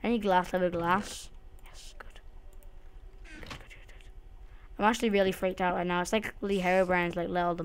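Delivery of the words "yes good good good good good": hushed whispered relief; relieved whisper. Real, not acted. Freaked-out player whispering relief as the fix works. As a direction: whisper it like you barely made it. Fixing the breach quietly.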